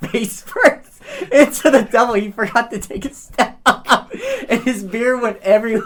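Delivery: can barely get it out for laughing. Real, not acted. Laughing through the punchline.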